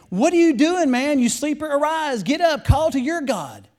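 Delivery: high, loud captain's demand; urgent rousing call. A narrator's reading. Captain to sleeping Jonah, urgent shouted wake-up.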